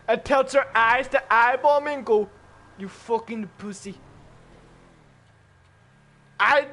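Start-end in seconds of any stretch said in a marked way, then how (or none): 2.8-4.0 put-on Russian accent. Grandmother sneers at her grandson in a soft Russian accent. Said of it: "in a Russian accent".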